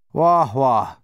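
scornful, disgusted wah wah; disgusted exclamation. Acted. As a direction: spit it out with disgust. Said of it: "exclaimed with disgust".

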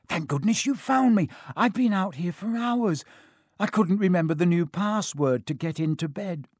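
high, plaintive relieved voice; anxious Neville. Acted. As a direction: pour out relief in a high, worried voice.

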